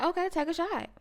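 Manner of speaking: lightly voiced mom calling his bluff; mom's retort. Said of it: "She's like".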